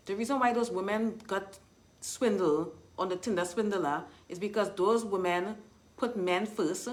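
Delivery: ordinary talk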